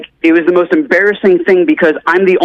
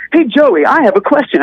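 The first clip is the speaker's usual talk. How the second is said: high, put-on presenter cheer; chipper host; 7 semitones higher than usual. Host coming over to a contestant with a question, chipper. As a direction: imitate a host's scripted friendly approach.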